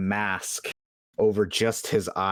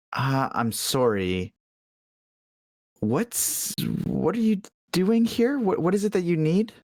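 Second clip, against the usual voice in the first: high, rising flustered questions; puzzled, flustered; 9 semitones higher than usual. Acted. Startled shopkeeper asks the adventurer why he is here, rising pitch. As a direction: squeak out confused questions.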